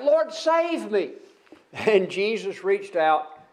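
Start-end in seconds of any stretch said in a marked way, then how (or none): 0.0-1.1 high, slow, pleading cry of a sinking man; cry for help. Acted. Preacher acting Peter sinking beneath the waves, high drawn-out plea. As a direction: call out to the Lord as the waves pull you down. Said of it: "And he cried out".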